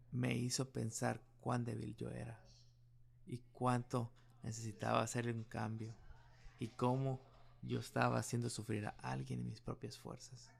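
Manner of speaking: normal talking voice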